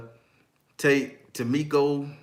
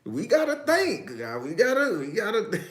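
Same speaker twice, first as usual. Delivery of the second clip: high voice breaking into laughter; helpless amusement; 8 semitones higher, 4 dB louder than usual. Real, not acted. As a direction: plead while starting to crack up. Cracking up over the suggestion.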